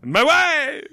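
put-on high, loud Borat accent. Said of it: "Very nice, Borat".

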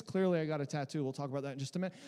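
ordinary talk

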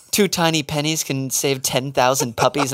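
mock the pleading puppy commercial announcer; charity ad voice. Mimicking the puppy charity ad he sees on TV, lightly mocking. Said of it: "Skip those ads".

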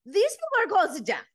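high, loud mock panic. Contestant surfacing from icy water, mock-panicked.

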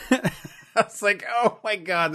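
high laughing squeal; can't stop laughing at the memory. Real, not acted. Remembering the film scene, laughing hard.

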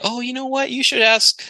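high-pitched, falsely helpful suggestion; mock-helpful. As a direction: helpfully pass them off in a high voice. Mock-helpful referral of a customer.

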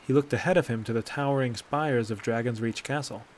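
ordinary delivery, nothing marked

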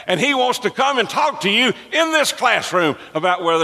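high, fervent pulpit cry; full-force preaching. Real, not acted. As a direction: preach it with rising fervor at a high pitch.

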